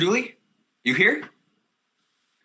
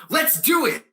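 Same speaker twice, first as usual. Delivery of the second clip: high, excited rallying cry; eager agreement; 9 semitones higher than usual. Acted. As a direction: jump at the plan. Acts out eagerly agreeing to scheme.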